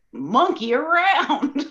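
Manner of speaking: playful commercial lilt; playful slogan. Acted. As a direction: stretch it out like a kids' ad. Acts out the place's slogan.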